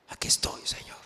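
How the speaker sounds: softly whispered surrender; whispered prayer. Acted. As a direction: breathe it out to God. Voicing a sinner's surrender prayer.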